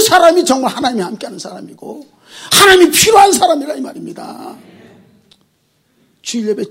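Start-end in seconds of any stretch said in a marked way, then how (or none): 2.5-3.7 higher, louder proclaim; preaching shout. Real, not acted. Preacher to the congregation, a loud emphatic shout. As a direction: hit the point hard. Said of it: "preaching climax".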